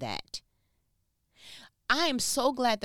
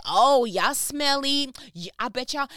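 slow, louder mock taunt; mock insult; 4 semitones higher, 7 dB louder than usual. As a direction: tease them like a rude girl. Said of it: "she was like".

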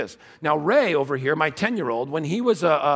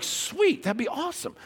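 mock delight; 14 semitones higher, 5 dB quieter than usual. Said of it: "And I'm like".

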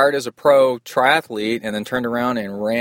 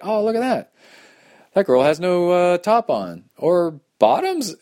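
much higher, hammed-up amazed gawking; mock surprise; 9 semitones higher, 3 dB louder than usual. Racer spotting the naked aid station, mock astonished. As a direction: gawk like you can't believe it, pitched way up. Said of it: "I was like".

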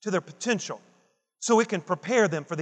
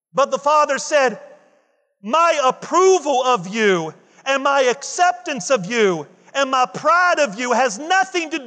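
loud, high, driving pulpit delivery; a fired-up Sunday sermon climb; full-force preaching; 6 semitones higher, 6 dB louder than usual. Acted. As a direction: preach it out to the whole church.

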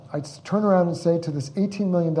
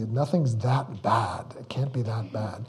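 self-soothing; 5 semitones lower, 5 dB quieter, slower than usual. Retold or imitated. Soothing himself in hard times.

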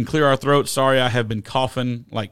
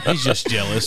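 laughing; 3 semitones lower than usual. Real, not acted. Teasing a guest, laughing.

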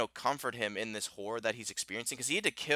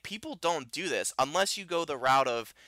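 ordinary delivery

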